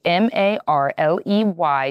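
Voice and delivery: M A R L E Y spelled slowly letter by letter. Shown as a demonstration. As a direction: spell M A R L E Y out, slow and clear. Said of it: "spelling out the brand".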